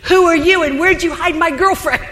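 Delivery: hammed-up, startled boyfriend asking where his girlfriend went. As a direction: blurt it like a baffled boyfriend. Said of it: "He's probably thinking".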